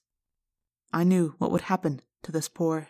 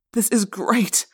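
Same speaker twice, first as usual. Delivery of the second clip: smiling delight; 3 semitones higher, 3 dB louder, slower than usual. Acted.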